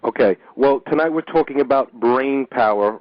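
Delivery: normal talking voice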